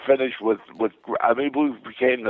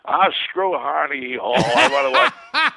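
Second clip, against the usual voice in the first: laughing through the quote, pitched up high; laughing dismissal; 7 semitones higher than usual. Retold or imitated. Groucho waving off the nurse about Carnegie Hall, laughing high. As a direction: brush it off while breaking into laughter.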